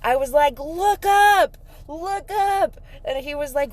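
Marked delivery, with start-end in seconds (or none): 0.6-2.8 high, loud call to a stranger. Retold or imitated. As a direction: call up to him excitedly. Calling out to homeless man.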